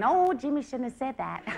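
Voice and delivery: lightly mocking prissy tone; a touch of polite disapproval.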